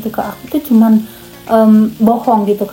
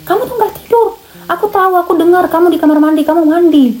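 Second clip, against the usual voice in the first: high-pitched imitation of a furious accusation; retold angry outburst; 8 semitones higher than usual. Host mother catching the au pair out, mock-furious accusing. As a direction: mimic an incensed host mother, pitch jumping up. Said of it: "Terus mama langsung ngamuk".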